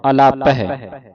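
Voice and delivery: ordinary talk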